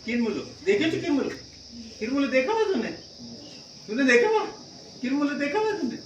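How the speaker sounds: high, loud playful Garhwali village voice. Pretend. Teacher playfully imitating a Garhwali speaker to pupils, loud and high. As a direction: ask like a Garhwali local, teasing.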